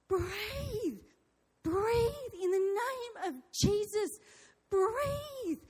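high, drawn-out desperate cry; screaming. Retold or imitated. Wife pleading with her dying husband to breathe, screaming. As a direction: re-enact a desperate command, pitch soaring. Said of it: "I just start screaming".

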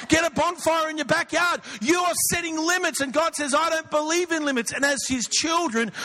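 rally the congregation to think bigger; full-force preaching. Acted. Pastor urges the church to drop limits: fervent preaching.

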